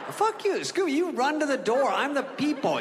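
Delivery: high-pitched, outraged protest; indignant retort. Retold or imitated. Telling Scooby to run to the door, indignant. Acted quarrel with the dog.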